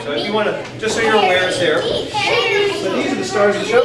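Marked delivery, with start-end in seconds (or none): 1.8-3.8 higher, slow, big showman's build-up; showman's announcement. Real, not acted. Teacher introducing the puppets to the audience, with showman flair. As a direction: present the stars like a ringmaster.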